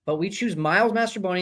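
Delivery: usual speaking voice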